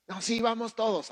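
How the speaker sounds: high, loud, eager mock answer; eager volunteering. Preacher imitating a rash believer answering for all, high and eager. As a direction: jump in for the whole group. Mimicking someone speaking for everyone.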